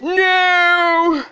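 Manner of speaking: high, loud, long cry; hammed-up horrified scream; screamed refusal. A narrator's reading. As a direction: scream it in horror, drawing out the vowel. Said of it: "The prince screamed".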